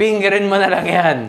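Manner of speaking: giggling through the words; laughing. Pretend. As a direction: laugh it out mid-joke. Joking line, laughing.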